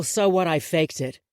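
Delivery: ordinary delivery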